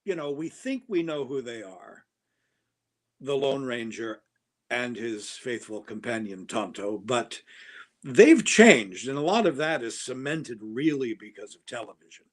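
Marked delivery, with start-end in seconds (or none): none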